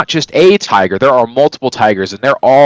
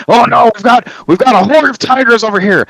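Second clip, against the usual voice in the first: mock panic; 12 semitones higher, 3 dB louder than usual. Mock panicked soldiers quote.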